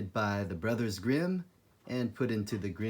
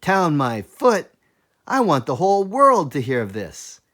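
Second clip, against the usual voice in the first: boastful; 6 semitones higher, 11 dB louder than usual. Acted. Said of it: "he shook his head and said".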